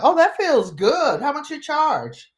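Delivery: joking with the security lady about her massage; flirty tease. Retold or imitated. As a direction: tease the screener as if at a salon. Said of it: "I'm like".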